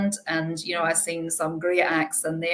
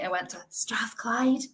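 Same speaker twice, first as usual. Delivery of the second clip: whispered aside, a little higher; joking whisper; 5 semitones higher, 4 dB quieter than usual. Real, not acted. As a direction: whisper it like a guilty confession. Joking aside about rival university.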